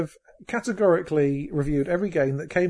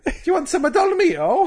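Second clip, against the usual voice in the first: put-on high, loud jokey Italian accent; cheesy Italian ad voice in a hammed-up Italian accent; 14 semitones higher, 7 dB louder than usual. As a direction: sell pasta sauce in a hammed-up Italian accent. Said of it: "you would know if I was doing an Italian accent".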